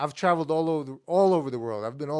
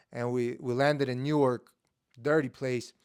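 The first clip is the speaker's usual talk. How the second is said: normal talking voice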